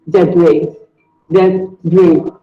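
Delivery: debris with a silent S, said day-bree; debris, ending in a long ee sound; correct pronunciation. Shown as a demonstration. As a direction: debris modelled slowly and carefully. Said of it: "The S is silent".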